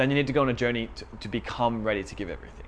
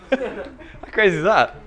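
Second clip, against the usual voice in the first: high, loud, drawn-out laughing exclamation; amused laughing; 9 semitones higher, 5 dB louder, slower than usual. Real, not acted. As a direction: laugh through the words in disbelief. Laughs after own acted quote.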